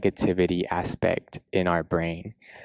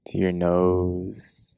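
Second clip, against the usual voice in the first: guided meditation; 4 dB quieter than usual. Acted. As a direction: guide them gently into a body scan, unhurried.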